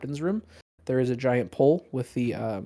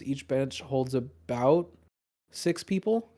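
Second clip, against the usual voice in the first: ordinary talk, nothing marked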